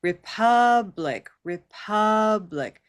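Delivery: very slow "Republic" as "re-PUUUHB-lik"; stretched middle stress. Shown as a demonstration. Teacher leading everyone to catch the vowel, slow "Republic" with long stressed uh. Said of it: "we'll do three times, and we'll catch the vowel".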